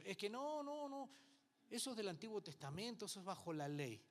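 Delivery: high, whiny objection; mock protesting objector. Preacher mimics churchgoers who refuse to tithe, in a high whine.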